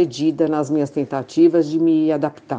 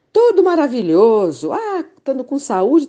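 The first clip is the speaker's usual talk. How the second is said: higher, gushing fake-happy answer; mock cheerful reply; 7 semitones higher, 4 dB louder than usual. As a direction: parody an over-sweet everything's-fine reply. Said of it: "Vem aquelas mensagenzinhas". (resposta falsamente animada, em tom agudo)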